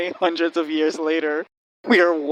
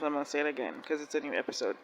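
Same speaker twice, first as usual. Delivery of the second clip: ordinary talk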